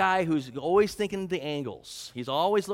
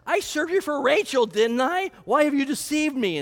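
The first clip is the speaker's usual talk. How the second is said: high, loud accusing cry; indignant outrage; 9 semitones higher, 6 dB louder than usual. Retold or imitated. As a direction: throw the accusation at him in outrage. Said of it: "look at what he says".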